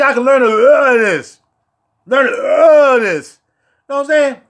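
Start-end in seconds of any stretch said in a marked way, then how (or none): 2.1-3.4 slowly savored. Shown as a demonstration. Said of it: "Almost said that L O word".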